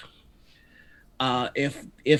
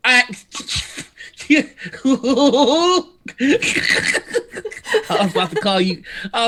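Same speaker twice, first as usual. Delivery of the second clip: laughing; 9 semitones higher, much faster than usual. Real, not acted. Laughing while talking.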